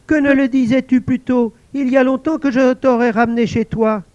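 high, pleased voice; a lonely spirit delighted at last; invisible servant exclaiming. Acted. Servant thanking the guard, high excited voice.

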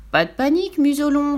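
high, teasing call; mocking. A narrator's reading. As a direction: make fun of someone's panic. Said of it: "se moqua-t-il". (se moquer d'une voix haut perchée)

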